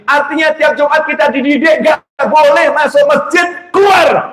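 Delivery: rising to a shout from the pulpit; fiery sermon climax; preaching shout. Real, not acted. Preacher drives home the point.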